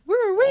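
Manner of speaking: high, loud whooped "woo, woo"; sudden mock-siren whoop; imitated toy siren. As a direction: mimic a police car siren. Imitates police car siren going off.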